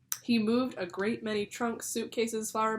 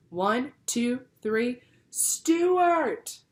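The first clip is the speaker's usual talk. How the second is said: higher, loud count into a shout; counting then calling; 5 semitones higher, 8 dB louder, much slower than usual. A narrator's reading. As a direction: count three and call his name loudly. Father counts then calls.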